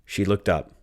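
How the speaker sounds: ordinary delivery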